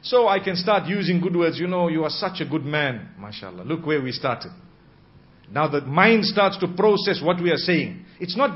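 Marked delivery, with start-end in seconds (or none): 1.6-4.5 soft, low, tender opening to a husband; gentle wife's voice. Shown as a demonstration. Wife gently praising her husband before raising his flirting, soft and low. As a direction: coax him gently with praise. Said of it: "there is a way of speaking".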